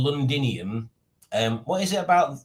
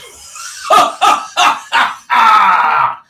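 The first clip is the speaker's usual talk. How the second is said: loud high shouted cackle, mimicking a friend; imitating Alex's laugh; 8 semitones higher, 11 dB louder than usual. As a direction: ham up her big laugh. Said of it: "Alex laughs like this".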